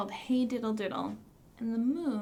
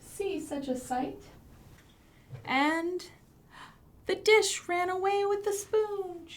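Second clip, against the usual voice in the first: sing-song nursery rhyme; 7 semitones higher, 3 dB louder than usual. Acted. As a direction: lead the kids through the rhyme. Recites rhyme with children.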